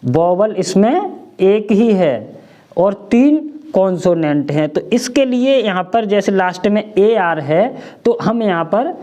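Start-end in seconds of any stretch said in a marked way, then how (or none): none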